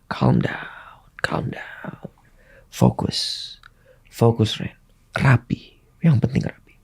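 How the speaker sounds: soft, hushed corner instructions; whispered coaching. Retold or imitated. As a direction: urge calm under the breath. Team calming him in ring.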